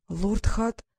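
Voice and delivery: halting, broken attempt at a name; struggling to speak. A narrator's reading. She greets the unexpected lord, struggling to say his name. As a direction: get the name out with difficulty, pausing midway. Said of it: "с трудом выговорила она его имя".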